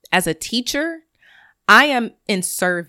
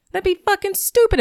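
exasperated; 12 semitones higher, 4 dB louder than usual. Real, not acted. Mocking thin-skinned people.

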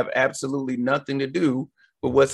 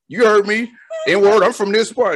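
taunting guy; 6 semitones higher, 3 dB louder than usual. Retold or imitated. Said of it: "the guy was like".

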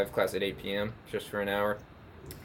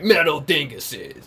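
slow booming announcer voice; monster truck announcer; 4 semitones higher, 4 dB louder, slower than usual. Pretend. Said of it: "monster truck announcer".

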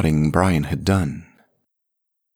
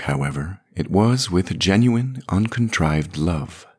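usual speaking voice

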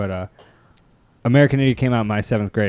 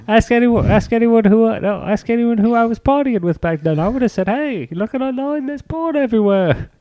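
mock guilty rock star; 11 semitones higher than usual. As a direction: beg the crowd to believe the star. Continues the mock defence.

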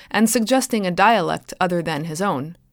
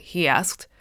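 ordinary delivery, nothing marked

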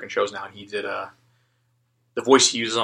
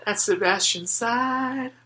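very slow, higher, lovestruck voicing of the smile; dreamy swoon; 9 semitones higher, 3 dB quieter, much slower than usual. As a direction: swoon the words like a lovestruck fan. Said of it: "That smile said".

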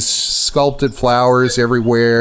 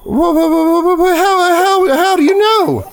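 put-on very high, louder stuttering old woman; distraught old mother; 3 dB louder than usual. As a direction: stammer in panic as a mother.

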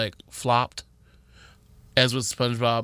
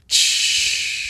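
shushing whisper. Retold or imitated. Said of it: "I'm like".

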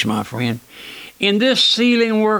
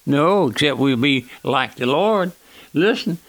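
usual speaking voice

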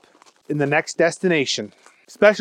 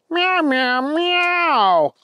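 high mewing kitten voice; cat meow; 12 semitones higher, much slower than usual. Acted. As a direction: mew it like a small cat. Cat signals the agent.

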